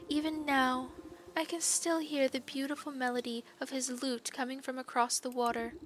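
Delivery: wistful maiden pining for her lover; lovesick maiden. Acted. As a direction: sigh it out like a pining girl. Maiden character voice.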